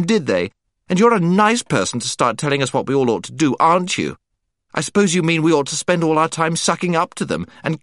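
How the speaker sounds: put-on angry girl scolding the boy; temper. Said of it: "Jill suddenly flew into a temper".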